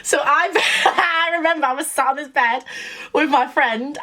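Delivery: high, giggly start to the story; laughing. Real, not acted. Laughing after playing his voice note.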